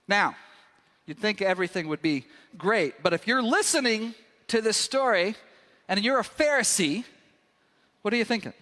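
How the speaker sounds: ordinary talk, nothing marked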